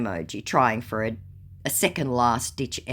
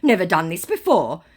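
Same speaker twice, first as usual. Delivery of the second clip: scammer voice; 5 semitones higher, 6 dB louder, slower than usual. Retold or imitated. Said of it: "To which he says".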